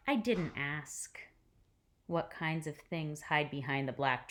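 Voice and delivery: gentle read-aloud storytelling voice; hushed, careful picture-book reading; story narration. A narrator's reading.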